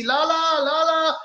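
high, loud repeated call; shouting at Afridi. Retold or imitated. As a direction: holler to get a player's attention. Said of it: "I'm shouting at Afridi".

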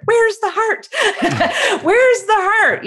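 high-pitched, laughing mock scream; hammed-up high protest breaking into laughter; playful mock scream. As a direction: cry it out in exasperation, laughing. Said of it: "I always wanna scream".

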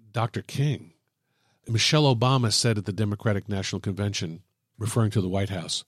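ordinary talk

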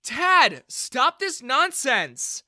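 loud, high, drawn-out rebuke; angry scolding shout. A narrator's reading. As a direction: shout him down, exasperated. Daughter cuts in on dad.

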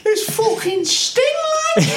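Sting in broad Geordie; Newcastle accent. Retold or imitated. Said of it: "but in a Geordie accent".

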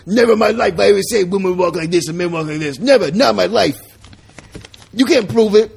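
loud, high, hammed-up protest; mock indignant denial. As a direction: deny it loudly in a put-on candidate voice. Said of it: "he'll be about".

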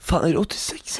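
shocked, hushed disbelief; breathless whispered surprise; surprised whisper. Real, not acted. Shocked at her age. (viskande förvåning)

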